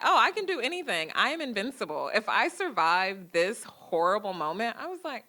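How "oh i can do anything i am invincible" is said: high, bold self-cheer; mock-proud invincible young self; triumphant. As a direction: crow it like you just won.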